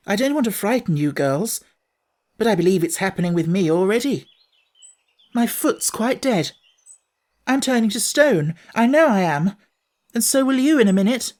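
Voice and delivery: high, tense, rising fear; a boy sure he is turning to stone; frightened boy. Acted. Cyril fears turning to stone.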